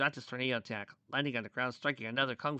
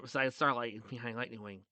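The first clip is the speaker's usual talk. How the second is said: plain reading voice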